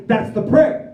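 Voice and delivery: high, loud disbelieving question; incredulous. Retold or imitated. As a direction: blurt it out, rising in mock disbelief. Retelling his disbelief at the prayer.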